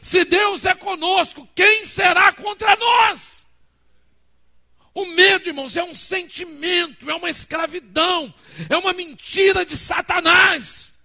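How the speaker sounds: loud, high, slow pulpit shouting; a pastor roaring to his congregation; fiery preaching. Real, not acted. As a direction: preach it with all your force against fear. Preaching at full force.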